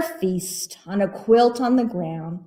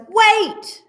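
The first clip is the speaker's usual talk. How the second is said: urgent call; 13 semitones higher, 4 dB louder than usual. Acted. Bear interrupts.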